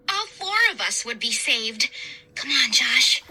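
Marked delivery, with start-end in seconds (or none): none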